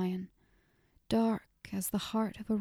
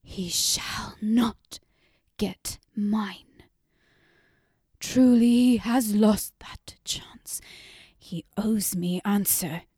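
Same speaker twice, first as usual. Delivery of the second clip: hoarse, intense whisper; hard whisper; 6 dB louder than usual. A narrator's reading. Mother vowing the god will never get her heart, hard whisper. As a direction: hiss the vow through the teeth. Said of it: "her voice dropped to a hard whisper".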